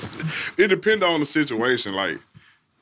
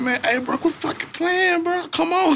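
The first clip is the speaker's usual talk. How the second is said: high-pitched exasperated retelling; exasperated plea. Retold or imitated. Barber to a little boy he is cutting, exasperated high-pitched plea. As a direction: plead at a fidgety kid, pitch jumping up.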